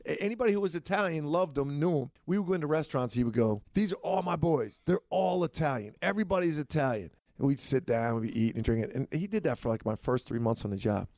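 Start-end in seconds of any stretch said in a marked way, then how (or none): 3.7-7.1 higher-pitched, lightly acted coach's boast; Coach Massimino. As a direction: lightly play a coach showing off his boys. Coach boasting to restaurant crowd.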